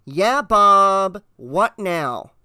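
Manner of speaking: a touch of weary irritation; lightly voiced grumpy dispatcher, pitch falling away; tired and grumpy. A narrator's reading. Night dispatcher answering the officer's radio call, tired and grumpy. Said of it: "tired voice came through as grumpy and lifeless".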